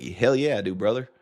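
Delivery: hammed-up Southern American accent. Mock patriotic American answering a quiz question, Southern accent. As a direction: put on a patriotic Southerner's twang. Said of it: "That was my Southern accent".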